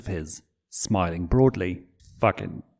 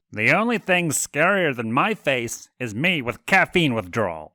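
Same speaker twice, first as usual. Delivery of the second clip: sass; 5 semitones higher than usual. Acted. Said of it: "with so much sass in his voice".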